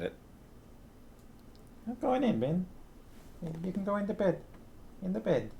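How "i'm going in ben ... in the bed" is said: high, soft coaxing pet talk. Real, not acted. Owner coaxing Ben the dog into bed with soft high pet talk. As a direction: coax the dog gently in a high voice.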